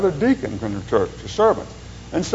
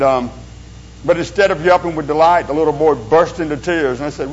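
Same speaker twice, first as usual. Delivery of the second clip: usual speaking voice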